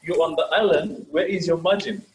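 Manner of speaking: normal talking voice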